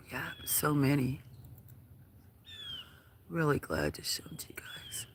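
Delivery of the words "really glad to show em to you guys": soft unvoiced whisper to viewers. Real, not acted. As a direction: hush it to the audience.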